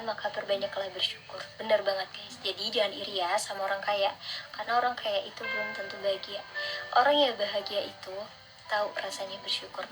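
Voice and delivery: ordinary delivery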